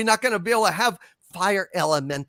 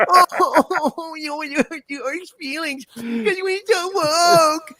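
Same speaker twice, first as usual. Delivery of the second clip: high, squeezed baby-talk mockery; sneering sarcasm pushed to a squeal; exaggerated sarcasm; 8 semitones higher than usual. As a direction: pour sarcasm into a ridiculous high coo. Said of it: "putting so much fucking sarcasm into my voice".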